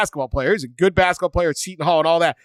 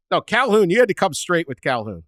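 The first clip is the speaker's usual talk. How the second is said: ordinary talk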